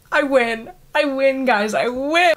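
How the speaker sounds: high, happy "I win" over and over; gleeful cheer. Real, not acted. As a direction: crow about winning. Celebrating the book she drew.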